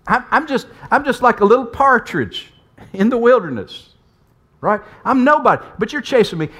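high-pitched, pleading complaint; acted grievance. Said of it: "he's talking about what he's been through here".

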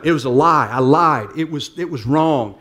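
it was a lie, said loud and flat; blunt honest confession. Shown as a demonstration. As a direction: own it plainly, no softening. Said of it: "say the same thing".

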